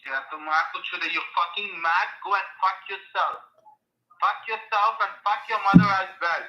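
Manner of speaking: furious, yelling profanities; angry cursing. Real, not acted. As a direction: spit the insults out in a rage. Scammer cursing out the caller.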